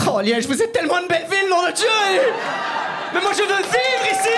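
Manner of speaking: high-pitched, hammed-up rapture; mock gushing. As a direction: cry out in exaggerated adoration of the city. Mock outburst praising Liège to audience.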